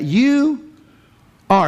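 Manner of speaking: "You" jumps high, pause, then "are"; emphatic you are. Shown as a demonstration. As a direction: lift "You" high and hold before "are". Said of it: "Put your finger on there".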